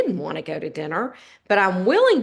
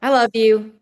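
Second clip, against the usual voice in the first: louder, tossed-off I love you; snippy I love you; 4 semitones higher, 7 dB louder than usual. Retold or imitated. Wife to husband on her way out, snippy and louder. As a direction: throw the words away, a bit irritated. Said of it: "it's just the tone of it".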